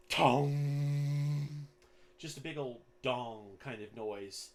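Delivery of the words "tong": loud, ringing comic clang; cartoonish metallic bong. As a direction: imitate a cartoon gong, holding the hum. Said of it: "the most comedic, cartoonish".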